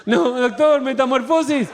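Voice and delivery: naive patient correcting the doctor. Retold or imitated. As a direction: Guido confidently saying metamorfosis.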